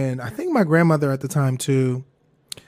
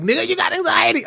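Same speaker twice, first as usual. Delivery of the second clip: high-pitched imitated outcry; mock exclamation; 14 semitones higher than usual. Viewers telling her it's anxiety, mock high exclamation. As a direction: shoot the pitch way up, imitating exasperated listeners.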